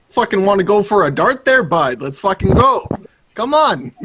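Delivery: put-on loud, rowdy Canadian accent; hammed-up Canadian, egging a buddy on. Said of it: "my Canadian".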